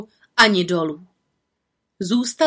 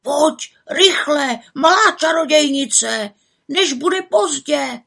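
higher, urgent raven voice; urgent plea; 6 semitones higher, slower than usual. Acted. Raven Krex urging the little witch to hurry. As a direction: hurry her along, slow at the end.

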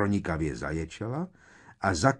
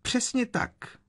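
very high-pitched squeal; high shriek; slower than usual. A narrator's reading. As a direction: shriek it in a tiny, piercing high voice. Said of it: "zaječela Umbridgeová ještě vyšším hlasem". (zaječet hodně vysokým hlasem)

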